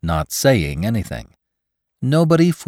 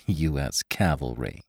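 low, soft scoff; scornful echo, dropped low and quiet; snorting; 6 semitones lower, 9 dB quieter than usual. A narrator's reading. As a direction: mutter it dismissively. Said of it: "Hathaway snorted".

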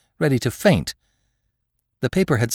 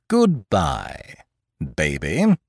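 patronizing; 4 semitones lower, much slower than usual. A narrator's reading. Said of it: "in a patronizing tone".

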